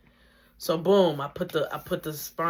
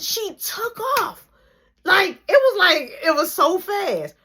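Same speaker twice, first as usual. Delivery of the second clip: excited retelling; 12 semitones higher, 6 dB louder, slower than usual. Retold or imitated. Retelling the sudden kick.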